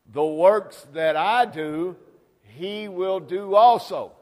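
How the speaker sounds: loud, high and slow, hammering "the works that I do"; preaching at full force. Acted. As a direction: drive the verse home with the congregation. Scripture cried out, then repeated together.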